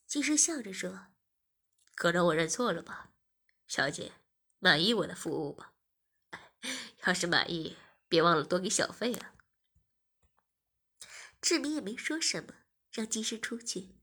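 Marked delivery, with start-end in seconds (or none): 1.9-9.3 low, forceful male character voice; male masseur figure, smug. A narrator's reading. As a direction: speak low with smug politeness.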